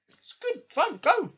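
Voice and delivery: high, loud hammed-up send-off; mock cheery shout. Adult cheerfully sending a ten-year-old off, loud and high. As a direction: yell it cheerfully, shoving the kid off. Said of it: "and going".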